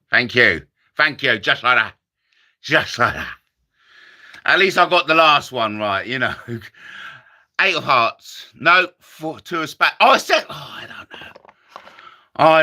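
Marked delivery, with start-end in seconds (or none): 1.4-3.3 low, growly Tommy Cooper catchphrase; deep comic conjurer voice. Retold or imitated. Comic doing Tommy Cooper's catchphrase, much lower. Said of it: "my Tommy Cooper impression". 10.5-11.4 breathy whispered line. Acted. Comic whispering to himself.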